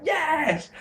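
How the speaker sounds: high-pitched mock cheer; excited cheer. Everyone in the arena reacting to Goldberg, excited. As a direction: imitate everyone cheering at once. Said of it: "'cause they all did, like".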